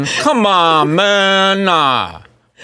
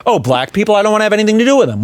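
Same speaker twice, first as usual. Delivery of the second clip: higher, very fast mock of a pundit; 8 semitones higher, much faster than usual. Mocking the cartoonist's remark, higher and rushed. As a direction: toss it off like it's nothing. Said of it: "than just saying that".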